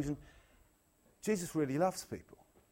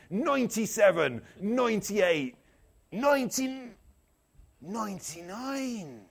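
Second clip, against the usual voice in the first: hammed-up West Country shepherd, higher-pitched; bad West Country accent; 5 semitones higher, 3 dB louder, slower than usual. Preacher playing a shepherd counting sheep, bad West Country accent. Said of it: "That is the worst West Country accent in history".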